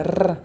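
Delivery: Rr with the tip of the tongue trembling; rolled tongue-tip R. Shown as a demonstration. Teacher demonstrates the trembling tongue-tip R. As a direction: trill a short r on the tongue tip. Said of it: "a ponta da língua treme".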